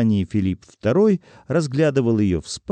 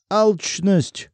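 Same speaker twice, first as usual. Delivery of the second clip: high-pitched exclamation; sudden indignant call; accusing cry; 8 semitones higher than usual. A narrator's reading. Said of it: "закричал Артём".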